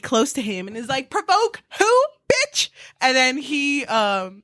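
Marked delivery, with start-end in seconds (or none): 1.1-2.7 high, squealing mock taunt; shrill-pitched provocation. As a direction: sneer it up high like a bratty dare. Heroine taunting Vane.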